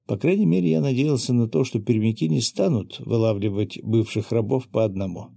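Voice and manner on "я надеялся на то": ordinary delivery, nothing marked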